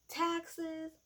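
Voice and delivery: high, loud mocking singsong of his talking points; mock droning politician; mocking rant. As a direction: parody his repeated lines, blah blah. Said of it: "That's all he kept saying".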